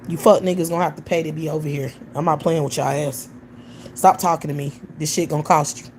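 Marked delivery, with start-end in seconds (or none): none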